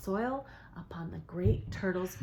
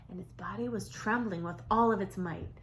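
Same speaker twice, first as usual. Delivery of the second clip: ordinary delivery, nothing marked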